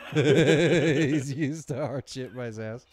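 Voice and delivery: loud laugh running into the words. Real, not acted. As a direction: crack up, then get the joke out. Cracking up at own joke.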